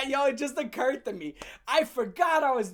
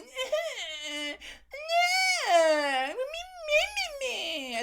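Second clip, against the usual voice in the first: high whiny fake crying; high mock sobbing; mock crybaby wail; 13 semitones higher than usual. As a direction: bawl like a big baby. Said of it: "A crybaby".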